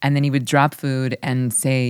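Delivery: ordinary talk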